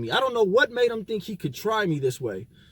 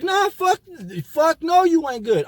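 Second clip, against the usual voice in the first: high, louder retold fuck no; retelling a hyped-up refusal; heated refusal; 8 semitones higher, 3 dB louder than usual. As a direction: snap back, still wanting the fight, higher and louder. Retold refusal to stop fighting.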